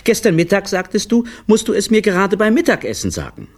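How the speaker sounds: high, quick reproachful voice; reproachful wife. A narrator's reading. Wife reproaches her husband over lunch excuses, high and quick. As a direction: throw his own words back at him. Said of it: "schaute ihn vorwurfsvoll an".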